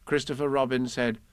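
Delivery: ordinary delivery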